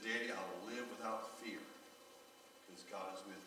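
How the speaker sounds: softly echoing the words, a touch weaker; a hint of a tired child repeating after her father; retold weak voice. Dying daughter repeating her father's vow, softly and a little weaker. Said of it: "in her little weak voice".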